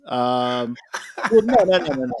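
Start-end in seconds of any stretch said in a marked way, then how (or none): 1.3-2.1 emphatic no. Real, not acted. Playfully refusing the pass.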